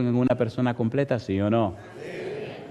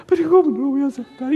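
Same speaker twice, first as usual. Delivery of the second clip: put-on very high, quick old man's wail; mock tearful voice; 13 semitones higher, 4 dB louder, faster than usual. Old father accepting his daughter's forgiveness, tearfully wailing. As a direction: imitate him bursting into tearful acceptance. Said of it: "empiezan a llorar, a llorar".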